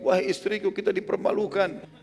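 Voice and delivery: high, flustered cry to his wife; bewildered alarm, pitched well up; panicked confusion. Acted. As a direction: call out in acted dismay. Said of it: "tapi dia bingung".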